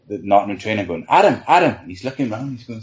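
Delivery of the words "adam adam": calling out loud to get his attention; shouting across. Retold or imitated. Goalie shouting to the rival trainer, calling his name. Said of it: "I'm shouting at the".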